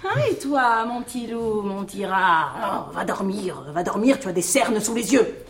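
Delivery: put-on gentle, babying affection; tender coaxing. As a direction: soothe him and send him off to bed. Soothes her darling, sends him to bed.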